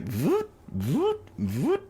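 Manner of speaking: rising robotic voot noises; mechanical voot sounds. Shown as a demonstration. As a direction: imitate stiff mechanical footsteps. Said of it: "That's how it moves".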